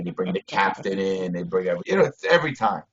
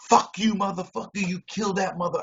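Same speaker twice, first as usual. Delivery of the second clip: retold outburst; 8 semitones higher than usual. Inmate cursing at guards who blame him, high angry retort. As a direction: fling it back at the accusing guards. Retelling his furious outburst.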